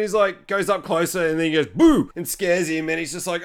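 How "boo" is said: sudden loud boo; mock scare. Man creeping up to scare him, sudden loud boo. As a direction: pop it out to startle.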